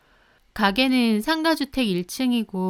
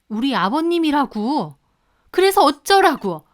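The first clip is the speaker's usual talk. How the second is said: higher and louder defiant yell; angry shout; 5 semitones higher, 6 dB louder than usual. Retold or imitated. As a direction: throw it back at her. Quarrel continues.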